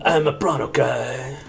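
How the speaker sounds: slow, lightly put-on prawn voice; Pepe Le Prawn. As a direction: drawl it like the Muppet prawn. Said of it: "doing a Pepe Le Prawn".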